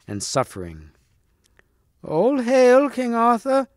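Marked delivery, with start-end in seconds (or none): 2.0-3.7 put-on very high, shrill old-hag cry; loathly lady's piercing, cracked greeting. A narrator's reading. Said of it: "she cried in a shrill, cracked voice".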